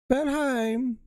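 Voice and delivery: put-on high, drawn-out German-accented papa calling; hammed-up German sing-out, pitched high; movie German accent. Papa searching for Valheim, high movie German accent. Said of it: "movie version of a, of a German accent".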